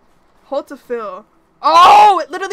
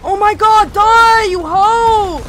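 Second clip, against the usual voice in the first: angry shout, much louder and higher; furious yell; 8 semitones higher, 13 dB louder, much slower than usual. Real, not acted. Streamer yells at the machine to die, furious. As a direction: scream at the enemy to die. Cursing enemy in tense fight.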